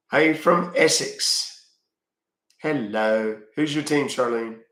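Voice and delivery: drawn-out Essex greeting; Essex accent. Pretend. Streamer greeting an Essex viewer, mock Essex accent. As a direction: put on a playful Essex accent. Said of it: "your Essex accent is better than mine".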